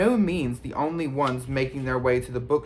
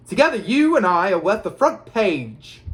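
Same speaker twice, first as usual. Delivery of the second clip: high, loud showman's voice; Gilderoy Lockhart; 5 semitones higher, 7 dB louder than usual. A narrator's reading. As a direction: boast grandly through a toothy grin. Lockhart boasts while posing for photographer.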